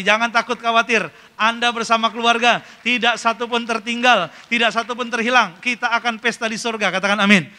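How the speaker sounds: loud, emphatic rallying of the congregation; full-force preaching. Real, not acted. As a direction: proclaim it forcefully, building to the amen. (khotbah lantang penuh semangat)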